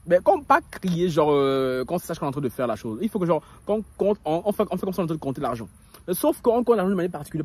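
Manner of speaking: usual speaking voice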